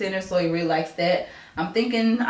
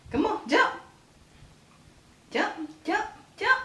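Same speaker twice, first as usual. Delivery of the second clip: high coaxing chant, much softer; 11 semitones higher, 5 dB quieter than usual. Real, not acted. Mother urging baby to jump.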